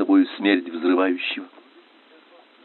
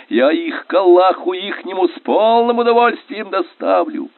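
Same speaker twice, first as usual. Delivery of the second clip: high, ecstatic battle yelling; triumphant, high-pitched hollering; elated shouting; 8 semitones higher than usual. A narrator's reading. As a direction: jeer and urge on at full cry. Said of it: "восторженно кричал минёр Виноградов".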